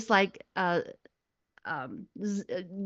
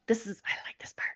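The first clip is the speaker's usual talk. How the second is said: quick excited whisper; whispered aside; 10 dB quieter, faster than usual. Real, not acted. Telling the hosts her favourite part is coming, whispered quickly. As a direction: lean in and breathe it fast.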